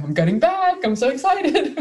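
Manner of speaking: high, giddy squeal of anticipation; hammed-up bubbly glee; bubbly, jumpy excitement. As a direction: bounce with excitement, pitch climbing. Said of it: "all bubbly and jumpy going".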